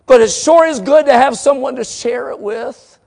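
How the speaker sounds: loud, high pulpit emphasis; emphatic preacher's assurance; full-force preaching. Real, not acted.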